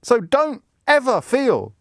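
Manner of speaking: high, hammed-up royal pep talk; mocking Harry. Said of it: "To say".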